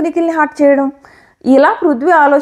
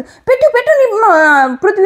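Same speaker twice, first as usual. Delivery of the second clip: retold urging shout; 6 semitones higher than usual. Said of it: "చాలా గట్టిగా అరిచింది".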